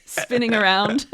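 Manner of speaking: giggling through the words, then a high laugh; laughing while spinning. Real, not acted. Co-host laughing while spinning in the studio. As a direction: laugh at your own silliness. Laughing at her own spin.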